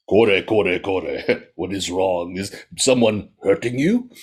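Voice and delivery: steady the frightened man; calm noodle seller. Acted. Soba seller calms the panicked merchant, low and soothing.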